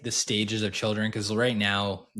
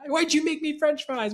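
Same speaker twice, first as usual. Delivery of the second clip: very high-pitched mock kid complaint; imitated whiny little kid; Leo. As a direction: mimic an accusing child, pitched way up. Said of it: "he just gaslights me all the time".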